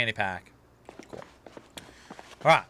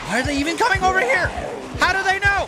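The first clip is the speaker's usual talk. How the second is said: panicked; faster than usual. Real, not acted. Mobs chasing him.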